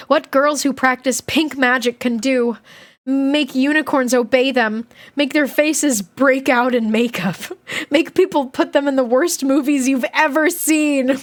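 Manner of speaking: animated, amused diary reading; lively read-aloud tipping toward laughter; story narration. A narrator's reading. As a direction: build the list with relish, leaning on key words.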